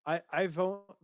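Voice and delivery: usual speaking voice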